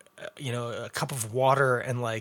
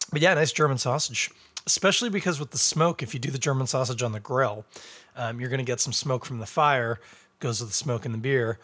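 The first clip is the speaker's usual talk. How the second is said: ordinary delivery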